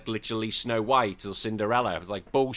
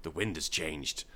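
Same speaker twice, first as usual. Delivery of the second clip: ominous mother; 8 semitones lower, 9 dB quieter than usual. Retold or imitated. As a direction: lower and softer, solemn foreboding. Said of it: "Jun suddenly looks and goes".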